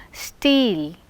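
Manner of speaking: "Steel" with no puff after the t; unaspirated t. Shown as a demonstration. Teacher demonstrating t sounds, steel without aspiration. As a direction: keep the t of "Steel" free of breath. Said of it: "There is no aspiration".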